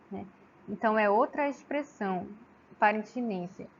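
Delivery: ordinary delivery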